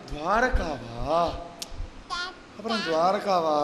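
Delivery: very slow, low, drawn-out question; stunned disbelief. Acted. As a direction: stretch the word out in acted dismay. Meera stunned by the guru's answer. (திகைத்துப் போன இழுத்த கேள்வி)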